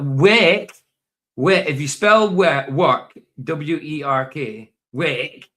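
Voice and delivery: Scouse demo, loud and stretched on "work"; Scouse accent. Shown as a demonstration. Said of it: "then you're a Scouser".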